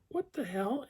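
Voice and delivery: high-pitched incredulous squeak; exaggerated high-voiced protest; acted disbelief. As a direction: squeak it in mock bafflement. Acting his disbelief.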